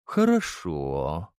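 slow and low. Acted. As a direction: draw the word out, weighing it. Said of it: "медленно произнёс император".

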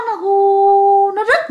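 high, long drawn-out "hu"; slow rising ghostly howl; imitated wind. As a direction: hoot like eerie wind down a phone line. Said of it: "giống như là có tiếng gió".

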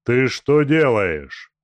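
loud, slow angry shout. Acted. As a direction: roar at the escaping captive. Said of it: "закричал Тумба". (закричал гневно)

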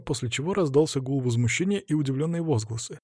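usual speaking voice

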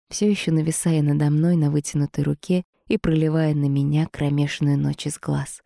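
usual speaking voice

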